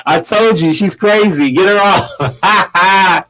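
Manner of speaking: playfully retold warning; imitated kid. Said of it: "the kid was like".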